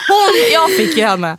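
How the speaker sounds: laughing helplessly at her own slip of the tongue. Real, not acted. Friend laughs at her own mix-up about having a sister.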